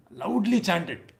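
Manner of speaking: LOUDLY CHANTED slow and high; emphatic stress. Shown as a demonstration. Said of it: "I loudly chanted the holy name".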